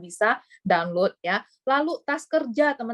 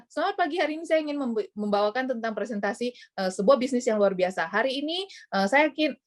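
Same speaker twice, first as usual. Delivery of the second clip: too fast; 3 semitones higher than usual. Shown as a demonstration. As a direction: rattle it off in a hurry. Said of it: "jadi terlalu cepat gitu loh".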